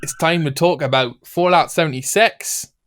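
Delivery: a Scottish comic voice; Scottish accent. Pretend. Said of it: "my Scottish accent you want me to do".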